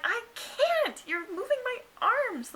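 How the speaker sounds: high, pleading cry of protest; desperate protest. Retold or imitated. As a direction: whine it out, trapped and hurting. Pinned to bed, arguing with nurse.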